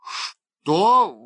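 high, loud cry of surprise; startled judge crying out; startled exclamation. A narrator's reading. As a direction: exclaim in astonishment, pitch leaping up. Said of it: "воскликнул судья".

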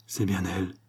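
murmured. A narrator's reading. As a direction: murmur it softly to yourself. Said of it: "murmurait Octave".

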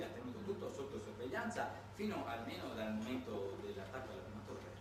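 ordinary delivery

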